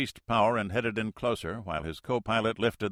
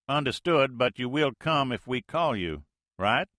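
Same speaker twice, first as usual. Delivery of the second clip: folksy Mississippi skipper talk, loud; Mississippi accent; 5 semitones higher, 5 dB louder than usual. Acted. Major answers the Navy plane in Mississippi accent, loud and folksy. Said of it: "The major's Mississippi accent".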